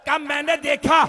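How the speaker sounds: ordinary delivery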